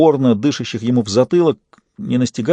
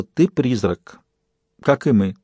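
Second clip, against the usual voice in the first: plain reading voice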